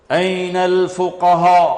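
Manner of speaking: slow, drawn-out announcer's call; proclamation. Acted. Announcer summons the jurists on Judgment Day, slow proclamation. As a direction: proclaim it slowly, syllables stretched. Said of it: "ایک اعلان ہوگا".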